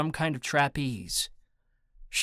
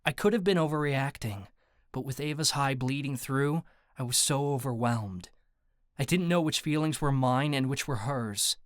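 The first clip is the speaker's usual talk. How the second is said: usual speaking voice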